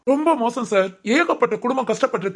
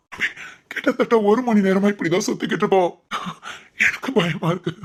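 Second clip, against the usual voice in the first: weep out the fear for his daughter; tearful worried father. Acted. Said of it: "sir, அழாதீங்க sir".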